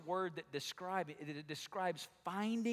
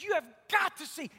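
excited; 10 semitones higher, 6 dB louder than usual. Retold or imitated. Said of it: "He says".

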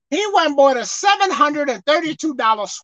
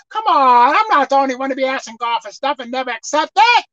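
ordinary talk, nothing marked

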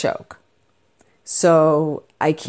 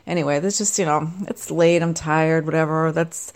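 ordinary delivery

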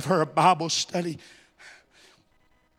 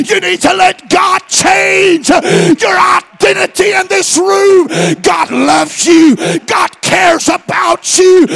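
full-force preaching; 10 semitones higher, 11 dB louder than usual. Real, not acted. Preacher urging the room to accept change, full-force preaching. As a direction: build to a high, loud preacher's shout.

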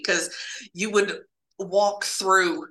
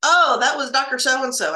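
a touch higher and louder, casual reveal; matter-of-fact coworker; 6 semitones higher, 6 dB louder than usual. Retold or imitated. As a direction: toss off the name like old news. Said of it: "He says".

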